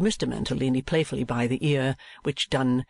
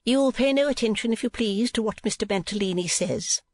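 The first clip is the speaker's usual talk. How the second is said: much higher, prim instructing voice; high-voiced madam; 9 semitones higher than usual. A narrator's reading. Wife telling the niece to ignore husband, high madam voice. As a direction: pitch it high as the wife in charge.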